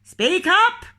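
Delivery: loud high shouted command; shouted order. Acted. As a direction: bark it across the counter. Grocer demands louder.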